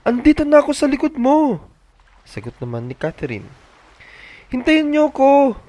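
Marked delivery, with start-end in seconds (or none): 0.1-1.6 put-on high, louder girlish child voice; young girl voice. Catherine calling to David while running, high girlish voice. 4.5-5.6 put-on high, slow whiny little-boy plea; near tears. Ben left behind begging siblings to wait, tearful whine. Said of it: "mangiyak-ngiyak na sagot".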